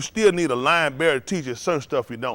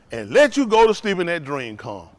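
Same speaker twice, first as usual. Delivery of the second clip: ordinary delivery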